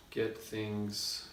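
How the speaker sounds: ordinary delivery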